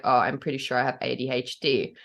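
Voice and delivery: low, understated admission; retold with a touch of sheepishness; sheepish. Client retelling what they told their therapist, mildly sheepish. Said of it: "I quite sheepishly the other day".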